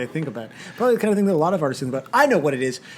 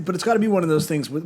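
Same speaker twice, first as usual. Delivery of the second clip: ordinary delivery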